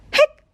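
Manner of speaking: sharp high hiccup sound; a little squeaky hic. Acted. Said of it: "Hic!" said Keeper".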